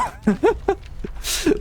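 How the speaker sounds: sharp airy laugh, high and breathless; a short bitter laugh at the audience. Real, not acted. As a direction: let a scornful laugh burst out. Bitter laugh mid rant.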